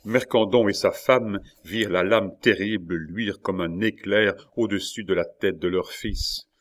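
usual speaking voice

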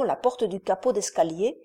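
ordinary delivery

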